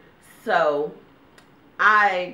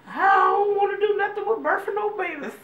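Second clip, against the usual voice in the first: high laughing refusal; 14 semitones higher, 4 dB louder than usual. Real, not acted.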